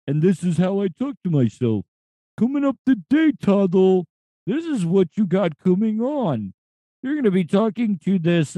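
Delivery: high, odd radio vulture voice; a cartoon vulture hosting a show. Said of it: "that's the voice that I'm working on".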